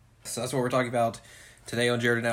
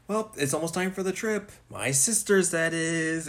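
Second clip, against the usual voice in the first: higher-pitched mock character voice; imitating a character; 7 semitones higher than usual. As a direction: put on a high little voice for the quote. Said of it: "He's like".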